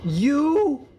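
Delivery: high, drawn-out curse that cracks; voice cracking. Real, not acted. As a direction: let the voice break, laughing midway. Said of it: "Did you hear that voice crack".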